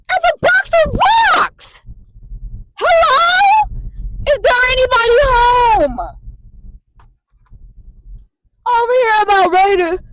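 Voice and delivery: very high, loud, strained yell; exasperated shout. Real, not acted. Caretaker venting about the patient, shrill exasperated shouting. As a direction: screech it at the ceiling in disbelief.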